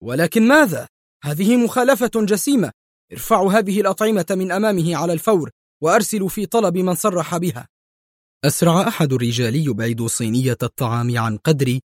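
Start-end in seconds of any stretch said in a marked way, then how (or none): 0.0-7.7 high, fast shouted orders; furious high-pitched yell; yelling in her face. A narrator's reading. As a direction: bark commands at the staff. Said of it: "صرخ في وجهها".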